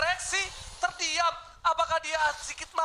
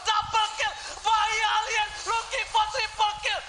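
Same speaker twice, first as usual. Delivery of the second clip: hyped shouting kill calls; fighting game announcer; 8 semitones higher than usual. Real, not acted. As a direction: yell it like a caster. Hype caster calling kills. (teriakan komentator heboh)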